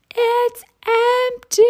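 sudden high, loud shriek of a frazzled mom; top of lungs yell. Retold or imitated. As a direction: scream it out, losing your temper. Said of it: "yelling at the top of my lungs".

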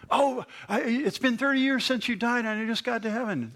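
amazed newcomer. Pretend. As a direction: squeak it out in happy astonishment. Newcomer replies in heaven.

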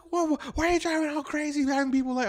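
very high-pitched imitation of scolding; indignant girlfriend. Girlfriend scolding him over his driving, high indignant voice. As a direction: mimic an outraged partner in a high voice.

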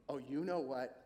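low, knowing jab; insinuating accuser. Acted. Satan accusing the believer, low insinuation. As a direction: drop low and imply the accusation.

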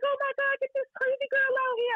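very high imitation of a frightened woman; mock panic. Rival reacting to her barging in, mock panic. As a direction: squeal it as the alarmed rival. Acted terrified rival during break-in.

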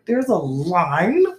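slow, drawn-out stunned question; mock disbelief. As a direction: let the disbelief stretch out. Retold disbelief at the line.

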